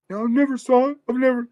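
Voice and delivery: high, squeaky fake-innocent denial; mock girlfriend. Girlfriend denies having seen the movie.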